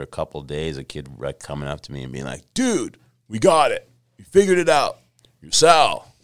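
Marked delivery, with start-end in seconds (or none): 2.5-6.1 loud, high SoCal surfer dude; SoCal accent. Retold or imitated. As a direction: play the SoCal stoner bro, loud and high. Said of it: "your SoCal voice is really good".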